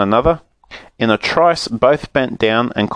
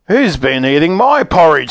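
higher-pitched, indignant bear demand; angry; 7 semitones higher, 4 dB louder than usual. A narrator's reading. Father bear finds the porridge eaten, angrily demanding. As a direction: demand it crossly, leaning on "my". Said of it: "said the father bear angrily".

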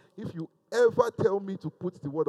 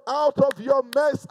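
emphatic; 5 semitones higher, 5 dB louder than usual. Retold or imitated. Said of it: "And God is saying".

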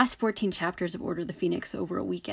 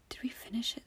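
softer, whispered question to herself; muttered aside to herself, much softer; 4 semitones higher, 12 dB quieter, faster than usual. Real, not acted. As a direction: check the memory under your breath. Whispered self-check aside.

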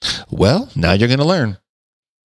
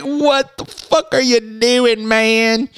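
high, slow, stretched-out disbelief; a lightly hammed-up shocked what-are-you-doing; mock incredulous; 13 semitones higher, slower than usual. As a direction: act appalled at the question. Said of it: "I was like".

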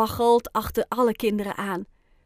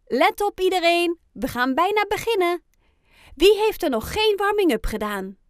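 higher, louder announcement to a group; teacher calling out; 7 semitones higher, 5 dB louder than usual. A narrator's reading. Teacher Annemarie addresses the children before the race, calling out. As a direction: call everyone to attention.

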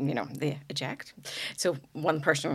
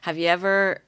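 ordinary talk, nothing marked

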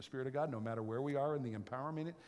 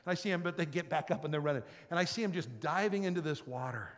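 normal talking voice

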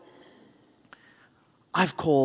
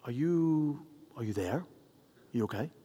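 soft, careful checking in; gentle concern; 8 dB quieter than usual. Retold or imitated. Caller hearing sniffles on the line, softly concerned. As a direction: drop the volume and check on them.

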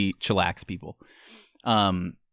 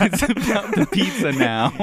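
high, hammed-up greedy whine; mock greedy; 10 semitones higher than usual. Joking with cohost about pizza greed, hammed-up high whine. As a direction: play it as a petty pizza-obsessed customer.

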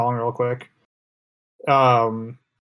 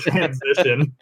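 ordinary talk

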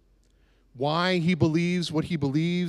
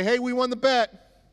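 gloating companions; 7 semitones higher than usual. Retold or imitated.

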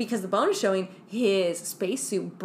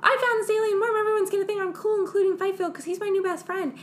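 high, eager mock nerd; nerdy scientist voice; 9 semitones higher, faster than usual. Nerdy scientist bragging about his alien worm, high and eager. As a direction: squeak it out like an eager nerd showing off.

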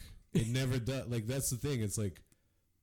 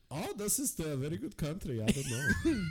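much higher fussy gentleman breaking into a laugh; mock posh gent; 9 semitones higher than usual. Imagining Frederick as a proper Brit, high mock voice. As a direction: play a prim old-fashioned Frederick. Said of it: "he could have been like".

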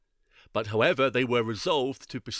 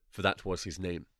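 usual speaking voice

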